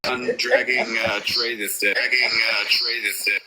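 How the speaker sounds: glitchy call audio with echo. Real, not acted. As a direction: voice repeating through a bad connection. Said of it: "Oh, yeah, you're glitching".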